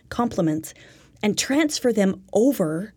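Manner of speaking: ordinary talk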